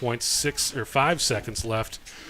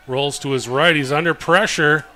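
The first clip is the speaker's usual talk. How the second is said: excited, louder football play call; live play-by-play; 4 semitones higher, 7 dB louder than usual. Real, not acted. Announcer to listeners on the half's last play, excited play-by-play. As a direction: call the action as it builds in pitch and volume.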